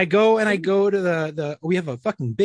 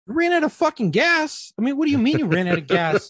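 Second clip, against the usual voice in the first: acted exasperated question, pitched way up; incredulous outburst; 10 semitones higher, 3 dB louder than usual. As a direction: throw the words back in disbelief. Husband reacts in disbelief to call.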